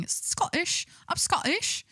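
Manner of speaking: mock Scottish accent. Shown as a demonstration. Streamer hunting for the Scottish thing in a mock Scottish accent. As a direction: caricature a Scottish accent in a high voice. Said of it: "what's the Scottish thing".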